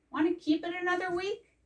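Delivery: light, high and fast, a kindly librarian; high quick librarian voice. Acted.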